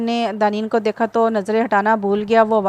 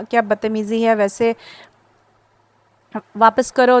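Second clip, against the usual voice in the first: angry. Acted. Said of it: "दानी ने गुस्से से कहा".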